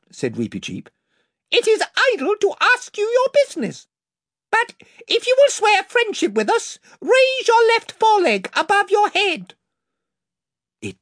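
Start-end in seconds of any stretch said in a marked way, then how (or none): 1.5-9.5 very high, loud, shrill mouse; a tiny knight piping out terms. A narrator's reading. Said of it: "came his shrill voice".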